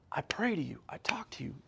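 soft, barely voiced plea; whispered prayer. Believer in a hard time talking to God, whispered.

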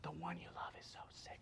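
hushed, unvoiced mock message; whispered quote. Mimicking Mary and Martha's message to Jesus, whispered. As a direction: whisper it as an imitated plea.

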